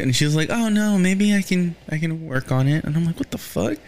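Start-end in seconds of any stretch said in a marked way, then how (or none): 0.5-2.8 higher, unsure little voice; worried self-doubt. Retold or imitated. Selena told her voice needs work, answering with higher worried doubt. 3.2-3.8 much higher, incredulous squeal; outraged disbelief. Retold or imitated. Fan watching the show, reacting with much higher outraged disbelief.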